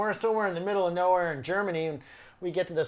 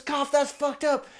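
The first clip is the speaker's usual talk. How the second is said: coughing complaint; 8 semitones higher, slower than usual. Retold or imitated. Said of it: "And Steve goes".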